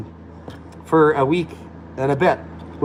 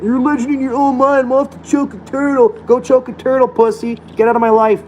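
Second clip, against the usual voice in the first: high, whiny mock read of a butthurt troll; mocking troll's message; 8 semitones higher than usual. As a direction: sneer it back in a sulky, high voice. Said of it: "Look at this Lawrence guy. He's, like, so butthurt".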